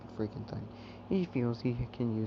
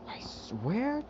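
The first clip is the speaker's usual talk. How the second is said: rising threat; 12 semitones higher than usual. Acted. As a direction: throw the swear up high and hard. Repeating the threat, building.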